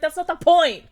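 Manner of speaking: high, loud indignant retort. Retold or imitated. Scolding Cameron on the beach, high loud indignation. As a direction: hit back at the boyfriend, loudly. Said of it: "I'd be like".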